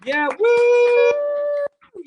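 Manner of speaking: high loud woo; cheering whoop for Karen. Real, not acted. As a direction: whoop like a crowd. Cheering a guest.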